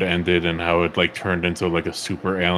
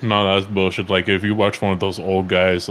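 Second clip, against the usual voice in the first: ordinary talk, nothing marked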